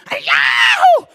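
high, loud imitated cheer; shouting football fan. Wife imitating her husband watching football, shouting. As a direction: whoop like a fan expecting a goal. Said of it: "sometimes when my husband is shouting".

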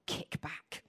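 low, whispery divine word; hushed. Retold or imitated. Preacher relays the word heard from God, hushed. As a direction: drop right down, almost whispering it.

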